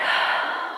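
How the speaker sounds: soft unvoiced sigh; tired sigh; weary sigh. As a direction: sigh of resignation. Crawdad gives in again.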